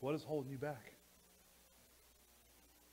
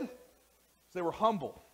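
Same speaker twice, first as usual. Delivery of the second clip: slow emphasis; 6 semitones higher, 11 dB louder, much slower than usual. Acted. Slowed emphatic point.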